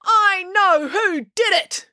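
high, drawn-out declaration; crying out. A narrator's reading. April claims she knows the culprit, high urgent cry. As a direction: proclaim it to everyone, slow and high. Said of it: "cried April".

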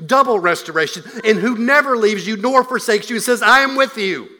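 loud, rising preacher's crescendo; full-force preaching. Acted. Preacher to congregation, rising to a loud proclamation. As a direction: preach it out, building to a peak.

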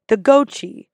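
gochi said as GOH-chee; mispronounced gnocchi. Retold or imitated. Server quoting guests, mispronounced "gochi" as GOH-chee. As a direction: botch gnocchi as "gochi", n dropped. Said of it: "that mispronounce this word".

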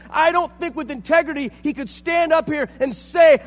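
loud, rising sermon with passion; fiery preaching. Acted. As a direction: preach it with fire and conviction. Preaching at full force.

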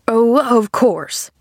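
slow, biting sarcasm; sharp, bitter interruption. A narrator's reading. Man interrupting the glad girl, sharply sarcastic. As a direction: cut in with drawn-out scorn. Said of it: "interrupted the man sharply".

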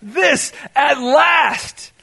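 loud, very slow, drawn out; joyful relief. Retold or imitated. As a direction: savor This at last in relieved joy. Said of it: "they're poetry, they're relief, they're joy".

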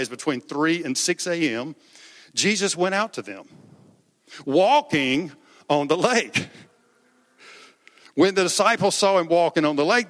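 ordinary talk, nothing marked

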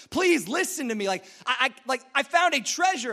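high, urgent plea to be believed; insistent, worked-up kid pleading; desperate pleading. Acted. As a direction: beg them to listen. Kid pleads with dismissive parents.

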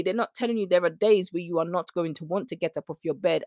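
ordinary delivery

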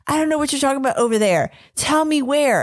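fast, lightly annoyed quote; retold coworker. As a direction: rattle it off, a little impatient. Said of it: "And he's like".

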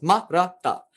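Ma, ra, ta as quick short separate syllables. Shown as a demonstration. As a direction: keep each of Ma, ra, ta brief. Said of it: "are all short syllables".

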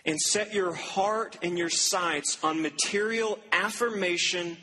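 slow, weighty, every word set apart; slow emphatic preaching. Acted. Preacher driving home the sermon's point, slow and weighty. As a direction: lay it down slowly like a verdict.

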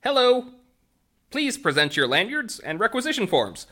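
high, loud service-bot greeting; robot clerk voice. Acted. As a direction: chirp it like a helpful bot.

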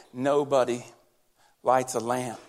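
low, flat droning recital; boring Sunday-school tone; flat dull reading. Retold or imitated. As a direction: say it like a dull lesson. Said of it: "He didn't say it like that".

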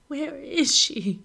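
high, loud, slow demand. A narrator's reading. Sobbing mother demanding where her baby is, fiercely. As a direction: demand it through tears. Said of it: "she demands".

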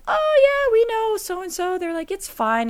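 high breezy small-town shrug; casual in-laws. Retold or imitated. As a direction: toss it off like relatives who find it normal. Voicing former in-laws casually.

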